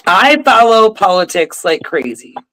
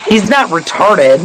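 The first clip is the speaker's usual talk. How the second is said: ordinary talk, nothing marked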